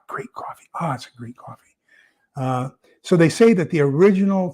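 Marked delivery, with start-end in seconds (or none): 0.1-1.8 whispered, savouring the taste. Real, not acted. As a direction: breathe it out in a hushed whisper over the cup. Savouring his last coffee.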